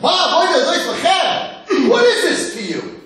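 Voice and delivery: high, drawn-out mock-furious yell; acted angry shout. Wicked son challenging the family at the Seder, angry shout. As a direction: holler it in outrage, stretched out and very high. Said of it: "He's angry".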